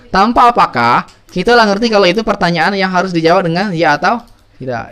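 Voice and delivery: ordinary delivery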